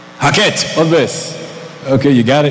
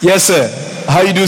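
normal talking voice

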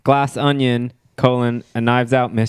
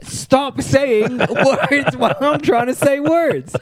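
high, slow laughing complaint; 11 semitones higher, slower than usual. Real, not acted. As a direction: laugh through the scolding.

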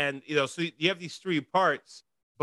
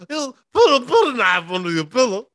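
higher, louder comic celebrity voice; Bill Cosby; 10 semitones higher, 8 dB louder than usual. Retold or imitated. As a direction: ham up a famous comedian. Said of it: "Bill Cosby".

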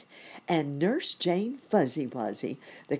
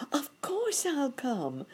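high-pitched eager cricket voice; cricket character; 11 semitones higher than usual. A narrator's reading. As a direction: accept as a jolly little cricket, pitched up. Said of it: "said the cricket".

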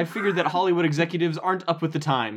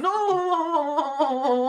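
put-on high wailing toddler no; baby tantrum cry; 12 semitones higher than usual. Westmore stomping in the office, toddler wail.

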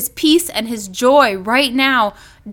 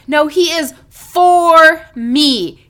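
for leaned on, slow and louder; stress on FOR; 2 semitones higher, 5 dB louder, slower than usual. Shown as a demonstration. Preacher insisting, stressing for. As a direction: press the word for firmly. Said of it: "The next one is the word for".

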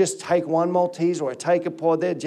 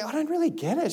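higher, softer imitated wife; puzzled wife; 7 semitones higher, 6 dB quieter than usual. Wife's puzzled reply, retold.

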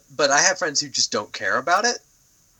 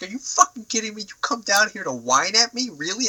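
exasperated disbelief; 11 semitones higher than usual. Retold or imitated. His exasperated reply to whining dragon.

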